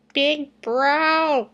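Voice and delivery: put-on high whiny kid voice; whining little brother. Little brother whining to wake his big brother. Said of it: "came a whining voice".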